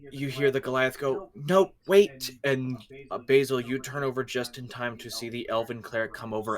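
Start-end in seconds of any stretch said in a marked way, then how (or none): 1.3-2.3 calling out. Acted. Goliath calling out to stop.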